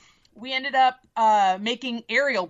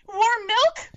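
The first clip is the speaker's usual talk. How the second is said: high, loud, innocent question; high mock princess voice; 13 semitones higher, 3 dB louder than usual. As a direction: ask sweetly like a naive princess. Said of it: "And she goes".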